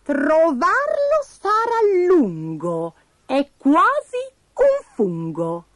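high, very slow recitation; squeaky oracle, word by word; Pasticciona's riddle. A narrator's reading. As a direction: stretch the rhyme out mysteriously.

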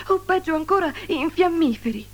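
high, sorrowful lament; sad moaning. A narrator's reading. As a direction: keep the worried groan going. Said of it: "gemeva tristemente". (lamento triste, voce acuta)

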